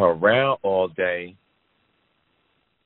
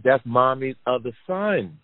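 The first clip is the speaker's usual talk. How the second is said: higher, slow, innocent explaining; higher girl's voice, slowing at the end; daughter's voice; 5 semitones higher than usual. Retold or imitated. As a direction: tell it gently like a kid. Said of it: "She said".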